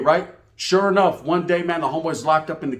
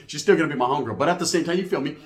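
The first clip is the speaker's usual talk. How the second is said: ordinary delivery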